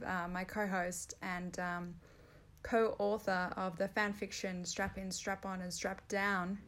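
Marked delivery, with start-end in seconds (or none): none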